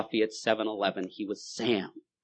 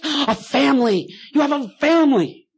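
much higher, slow, louder mock gasp; mock astonishment; 8 dB louder, slower than usual. God feigning shock at the drunk's family.

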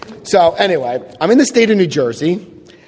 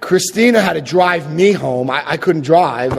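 normal talking voice

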